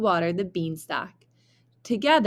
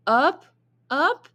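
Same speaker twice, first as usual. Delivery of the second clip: each up pitched much higher and louder; rising up; 9 semitones higher, 5 dB louder than usual. A narrator's reading. Narrator lifts each word as the stalk grows higher. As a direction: climb the voice up the stalk.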